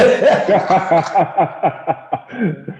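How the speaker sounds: long, high-pitched laughing fit; self-deprecating laughter. Real, not acted. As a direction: crack up at your own disaster. Laughs at own disastrous debut.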